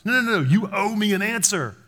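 high, insistent mock protest; acted-out high-pitched demand; indignant demand. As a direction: object hotly as if owed something. Acted demand of God for answer.

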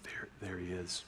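whispered, low and soft; hushed welcome. Retold or imitated. God greeting someone entering, in a soft whisper. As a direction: breathe it out like a gentle secret. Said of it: "And he's like".

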